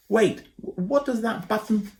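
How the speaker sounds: put-on high, flustered boy voice; boy Ben, flustered. A narrator's reading. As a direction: squirm out of the job, panicky. Ben's voice cut off.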